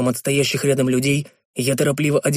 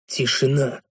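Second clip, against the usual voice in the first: ordinary delivery, nothing marked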